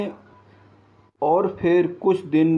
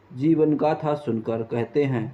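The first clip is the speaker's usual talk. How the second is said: ordinary delivery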